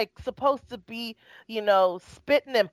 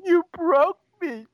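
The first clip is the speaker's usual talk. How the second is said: lovesick whine; 8 semitones higher, 4 dB louder than usual. Retold or imitated. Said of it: "he acting all sprung".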